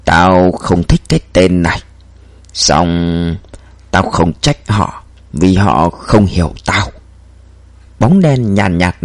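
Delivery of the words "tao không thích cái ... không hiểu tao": a low, cold killer speaking calmly; slow menacing murmur from the dark. A narrator's reading. Shadow killer voice.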